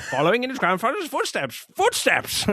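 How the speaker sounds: put-on high, stiff German-style accent; Inspector Kemp's accent. Fan imitating Inspector Kemp's German accent. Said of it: "Inspector Kemp with his great accent".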